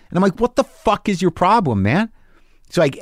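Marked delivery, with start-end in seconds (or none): 0.4-2.1 high, exasperated challenge; indignant demand from a retold row; retold angry confrontation. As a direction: replay squaring up to him, pitch raised. Acted hallway quarrel.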